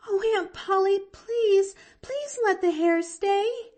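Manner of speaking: put-on very high, loud, tearful girl; tearful pleading. A narrator's reading. Girl begging her aunt about the hair, tearful pleading.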